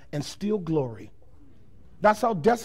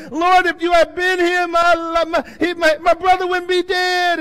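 high, loud anguished cry; pleading grief; 8 semitones higher, 6 dB louder than usual. Retold or imitated. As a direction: beg him through tears. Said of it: "she says the same thing".

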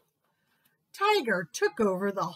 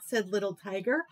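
plain reading voice

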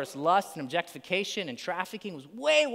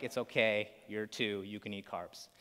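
lower, much softer comforting voice; gently calm a crying two-year-old; soothing dad; 7 semitones lower, 5 dB quieter than usual. Retold or imitated. Dad soothing his little son.

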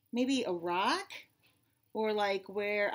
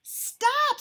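high, loud cry of alarm; sudden high-pitched shout; screamed warning; 9 dB louder than usual. A narrator's reading. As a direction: scream it out as a startled sparrow. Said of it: "screamed a sparrow".

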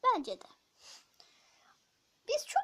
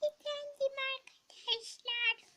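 high, strained put-on voice; high odd voice; 10 semitones higher than usual. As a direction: squeak it out oddly. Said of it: "Neden sesini böyle çıkarıyorsun?".

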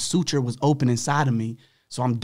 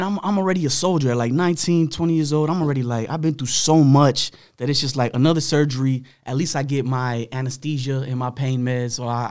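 ordinary talk, nothing marked

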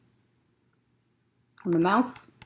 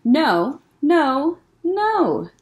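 gleeful no's climbing up and down; sing-song refusal, having fun; a squirrel enjoying saying no; playful repeated no; 4 semitones higher, 5 dB louder, much slower than usual. A narrator's reading. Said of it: "he's having some fun saying no".

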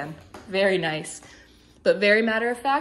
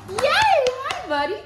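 excited praise; 4 dB louder, slower than usual. Real, not acted. Trainer greets released dog.

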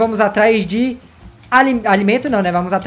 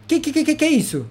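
higher stuttering what-is-this; flustered higher stammer; baffled stammer; 6 semitones higher, 5 dB quieter than usual. Real, not acted. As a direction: trip over the que in confusion. Baffled by the wrong block.

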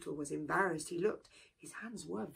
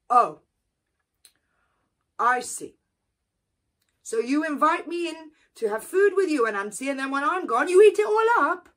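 louder, higher accusation; accusing; 7 semitones higher, 11 dB louder, faster than usual. Acted. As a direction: confront the cheat. Turtle accuses Anansi.

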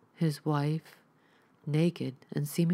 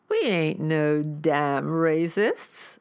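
a slow, louder southern cultist protesting; objecting rifleman; 4 dB louder, much slower than usual. Acted. Rifleman objects to Neil's insult, slow and indignant. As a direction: drag it out, offended. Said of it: "objected a man holding a rifle".

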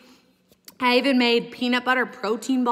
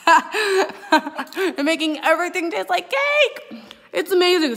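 laughing; 8 semitones higher, 4 dB louder, slower than usual. Real, not acted. Laughs while gushing about taste.

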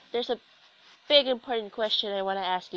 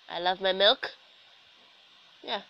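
ordinary delivery

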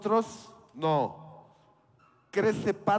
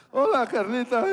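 mock teen greeting; 5 semitones higher, 6 dB louder than usual. Teen boy greeting Carlita.

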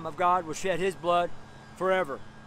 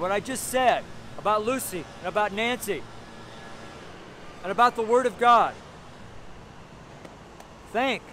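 forceful, raised sermon voice; preaching; 4 semitones higher than usual. Real, not acted. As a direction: drive each phrase home to the crowd.

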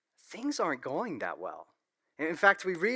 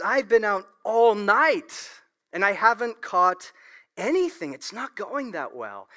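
broken, hurting man; 5 semitones higher, 7 dB louder than usual. Retold or imitated. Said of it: "a broken, hurting man".

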